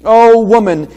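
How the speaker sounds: Oh woman said loud with feeling; emotional Oh. Shown as a demonstration. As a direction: give the Oh full emotion. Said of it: "And notice the emotion here".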